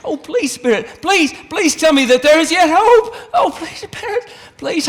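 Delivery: cling to the last shred of hope; desperate begging. Acted. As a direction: Scrooge begging the spirit to say there is hope. Scrooge begs for hope.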